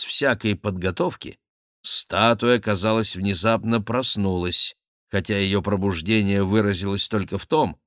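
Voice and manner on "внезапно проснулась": ordinary delivery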